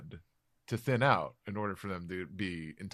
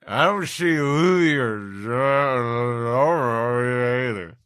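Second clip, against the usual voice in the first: put-on slow, slurred drunk voice, much louder; drunk Usopp; 5 semitones higher, 12 dB louder, slower than usual. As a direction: drag the words out like a sloppy drunk.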